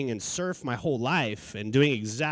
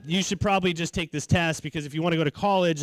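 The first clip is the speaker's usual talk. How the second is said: ordinary talk, nothing marked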